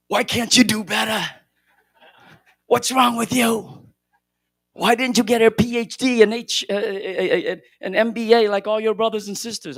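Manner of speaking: hammed-up nagging mother yelling at her son.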